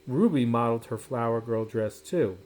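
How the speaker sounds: plain reading voice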